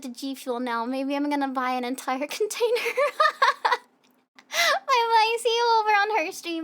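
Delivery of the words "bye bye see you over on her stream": cheery goodbye. Real, not acted. Ending stream cheerfully.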